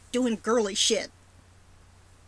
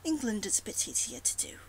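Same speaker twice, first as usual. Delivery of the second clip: posh English accent in a murmur; English accent, talking on in it; upper-class English accent. Shown as a demonstration. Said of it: "I always end up sounding like upper class".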